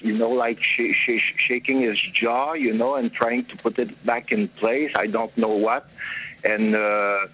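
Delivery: ordinary talk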